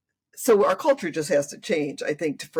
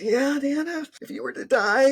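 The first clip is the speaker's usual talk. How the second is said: put-on much higher child voice; little granddaughter; 9 semitones higher than usual. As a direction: chirp it like an innocent seven-year-old. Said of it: "She goes".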